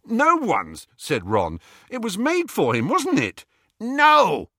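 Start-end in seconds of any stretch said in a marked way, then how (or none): 0.0-0.9 high, loud comeback; indignant retort. Acted. Ron retorts in the quarrel. 1.9-3.5 high-pitched, protesting question; indignant argument. Acted. Ron argues back at the goblin. 3.8-4.5 furious goblin shout, high and loud; angry cry. A narrator's reading. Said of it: "cried the goblin, bristling with anger".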